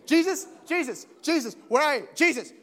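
father searching the crowd, calling for Jesus. Pretend. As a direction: call his name across the crowd.

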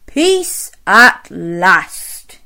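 slow, spaced-out relief; relieved Mister Bear. Acted. Mister Bear closing his eyes in bed, slow relief. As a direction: savour each word to yourself. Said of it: "he said to himself".

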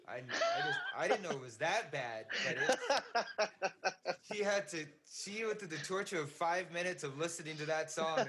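laughing while talking. Real, not acted. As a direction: crack up at your own misfortune. Laughing at own bad singing story.

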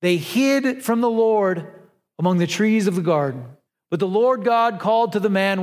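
usual speaking voice

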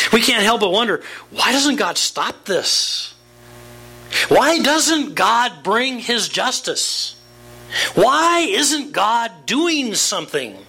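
high, slow, pleading sermon voice; impassioned preaching. Acted. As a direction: ask it like a cry to heaven, slowly. Preacher pleads the why questions.

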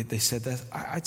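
ordinary delivery

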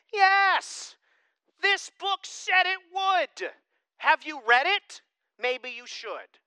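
loud, high, drawn-out exclamations easing at the end; emphatic preaching. Real, not acted. Pastor answering shocked Christians, emphatic and loud. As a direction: fire back emphatically, stretching the words.

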